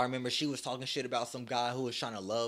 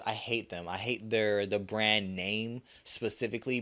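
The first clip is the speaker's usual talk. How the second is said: ordinary talk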